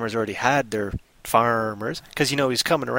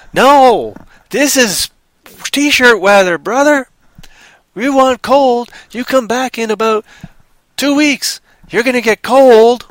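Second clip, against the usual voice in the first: much higher, louder farmer bragging; loud boasting farmer; 9 semitones higher, 7 dB louder than usual. Acted. Farmer brags about real cold.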